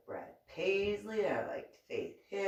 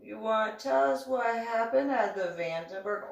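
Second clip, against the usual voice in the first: prodding question; 5 semitones higher, 4 dB louder than usual. Retold or imitated. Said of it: "they're just like".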